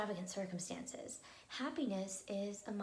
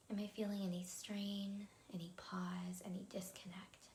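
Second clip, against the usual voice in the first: guided meditation. Real, not acted. Leads breathing meditation.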